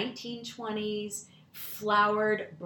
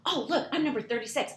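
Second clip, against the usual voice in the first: put-on high-pitched child announcing a discovery; imitating a chirpy kid; excited little girl; 6 semitones higher than usual. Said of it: "She goes".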